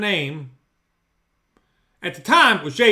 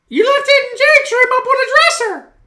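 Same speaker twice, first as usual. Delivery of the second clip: high, loud mock-wife voice; imitating his wife; 6 dB louder than usual. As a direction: put on his wife's voice, much higher.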